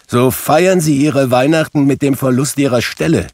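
high, loud rebuke; irate boss scolding an underling; angry threat. A narrator's reading. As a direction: threaten to sack him. Scrooge threatens clerk with dismissal.

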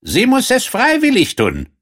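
hoch gespielter Frosch, selbstbewusst. Acted. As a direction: den Frosch deutlich höher sprechen. Frosch besteht darauf. (der Frosch erklärt dem König seine Tat, hoch gespielt)

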